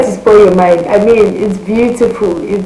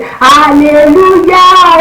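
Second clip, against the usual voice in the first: high, loud, very slow jubilant shout; shouting hallelujah; 8 semitones higher, 6 dB louder, much slower than usual. Retold or imitated. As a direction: praise God at the top of the voice. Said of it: "my mom was shouting".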